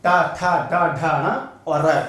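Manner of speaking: टा ठा डा ढा ना र read slowly and loud; slow letter reading. Shown as a demonstration. Teacher reading the letters again for the class. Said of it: "फिर पढ़ो".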